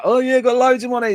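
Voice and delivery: high, bright boast about the cash; mock cocky tourist. Tourist brags to himself on arrival, high and cocky. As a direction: brag like a tourist flush with cash. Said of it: "you go".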